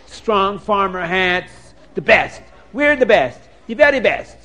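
slow, emphatic list, each item savoured; boastful sales pitch. Acted. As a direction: brag like proud tailors, punching every "best". Tailors boast of their clothes.

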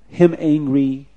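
ordinary delivery, nothing marked

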